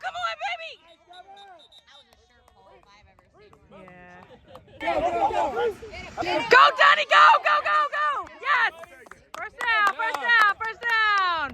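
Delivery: high excited shouting; yelling from the sidelines. Real, not acted. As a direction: yell the runner on. Cheering runner from sideline.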